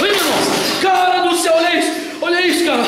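excited shout. Real, not acted. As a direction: react to something unbelievable. Spooky event, excited. (grito empolgado e assustado)

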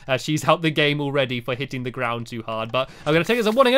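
ordinary talk, nothing marked

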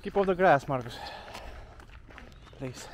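ordinary talk, nothing marked

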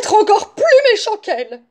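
high, loud outburst; loud, high-pitched wolf exclamation; exclaiming wolf. A narrator's reading. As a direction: cry out the sudden plan. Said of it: "s'écria le loup".

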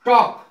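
टॉक high and loud, no L before K; silent L. Shown as a demonstration. Teacher presenting the word talk, टॉक with silent L. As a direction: call out टॉक without the L. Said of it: "एल का उच्चारण नहीं होगा".

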